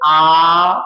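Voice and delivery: आ drawn out slowly, long aa vowel; long vowel demo. Shown as a demonstration. Teacher stretches आ to show a long vowel. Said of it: "इसको बोलने में थोड़ा सा कम समय लग रहा है".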